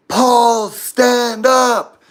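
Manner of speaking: loud, high-pitched yell; shouted command. Retold or imitated. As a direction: holler it at a floundering teammate. Shouting at teammate in water.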